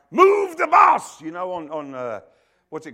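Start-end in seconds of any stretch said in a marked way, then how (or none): 0.1-1.2 high, loud, slow chant; TV show shout. Retold or imitated. Crowd on Extreme Makeover, loud slow shout. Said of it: "I was talking last night about".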